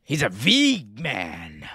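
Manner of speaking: whispery, strained character voice with dragged-out words; disgusted boss impression. Acting a boss mocking a wrestler.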